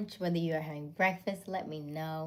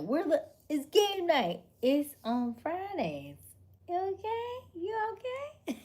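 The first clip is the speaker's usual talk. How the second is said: 1 mock whiny viewer; 10 semitones higher, slower than usual. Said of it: "gold night's like".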